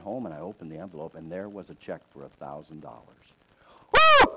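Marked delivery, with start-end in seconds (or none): 3.9-4.3 high, loud whoop of joy; big lottery-winner "woo"; excited whoop. Retold or imitated. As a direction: let out a jubilant yell. Retold joy at the check.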